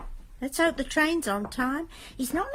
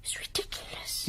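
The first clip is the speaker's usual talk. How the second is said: soft, voiceless whisper; whispered disbelief back to her son; whispered dismissal; 7 dB quieter than usual. Acted. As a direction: hush it, half afraid. Mother answers the hint, whispering.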